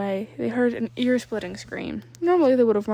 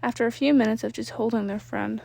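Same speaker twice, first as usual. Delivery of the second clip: usual speaking voice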